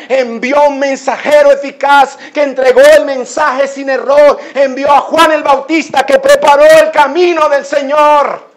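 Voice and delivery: loud, high, driving sermon climax; fervent shouted preaching; full-force preaching. Acted. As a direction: proclaim it at the top of your voice.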